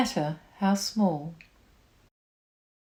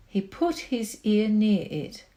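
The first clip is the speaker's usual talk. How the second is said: ordinary delivery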